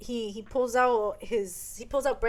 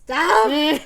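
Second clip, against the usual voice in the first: sudden loud, higher mock warning; retold warning shout; 5 semitones higher, 11 dB louder than usual. Brandon warns the other man off, loud mock warning. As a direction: bark it out as a quoted warning.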